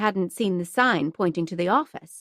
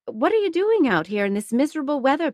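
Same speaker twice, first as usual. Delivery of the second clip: higher, fast scolding matron; fussing older woman; 6 semitones higher, faster than usual. Acted. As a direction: fuss like a worried landlady. Mrs Hudson scolding voice.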